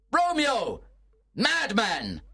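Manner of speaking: high teasing shout across the garden; calling out. A narrator's reading. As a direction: call after him, mocking. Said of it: "Mercutio called out".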